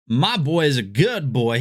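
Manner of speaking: slow exaggerated Southern accent; fake Southern accent. Re-reading a chat line in a fake Southern accent. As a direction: lean into a fake Southern sound. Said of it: "obviously Southern accent".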